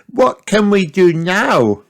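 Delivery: put-on high eager child voice; excited little girl. A narrator's reading. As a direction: ask bursting with energy. Peppa eager.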